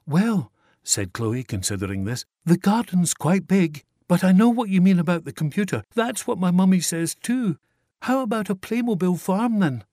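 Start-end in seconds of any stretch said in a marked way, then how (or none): 0.1-0.5 put-on higher, louder, quick child's request; a child rushing her wishes out; eager little girl. A narrator's reading. Chloe considering. 2.4-9.8 put-on higher, louder, quick child's request; a child rushing her wishes out; eager little girl. A narrator's reading. Chloe bargaining with Santa.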